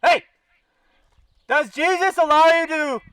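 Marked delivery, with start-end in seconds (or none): none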